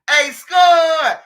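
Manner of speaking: loud, high shout across the street; calling out. Retold or imitated. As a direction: yell his name so he hears. Calling out to shooter.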